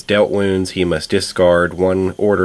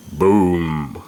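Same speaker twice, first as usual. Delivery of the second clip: lower, drawn-out monster boom; deep Boomer voice; 3 semitones lower than usual. Acted. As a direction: imitate a stomping brute's deep call. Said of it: "in a deep voice he goes".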